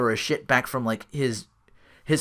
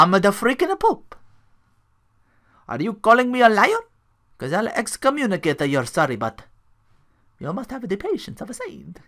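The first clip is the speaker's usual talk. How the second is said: put-on higher Italian Pope accent, ending up on Pope; light comic Italian accent as the Pope; Family Guy Italian Pope accent; 8 semitones higher than usual. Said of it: "It's, it's fun accent".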